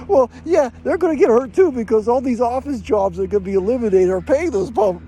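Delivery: mock the friend getting heated; imitating angry friend. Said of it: "it just gets him mad".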